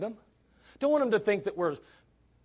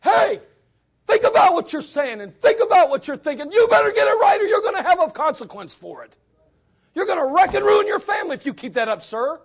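stern shout; 10 semitones higher, 9 dB louder than usual. Retold or imitated. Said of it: "I'm saying".

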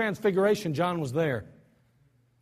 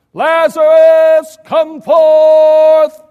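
drawn-out, high, loud summons; loud cry; 7 semitones higher, 15 dB louder, much slower than usual. Acted. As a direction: call the dead man out of the tomb. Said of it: "he cried with a loud voice".

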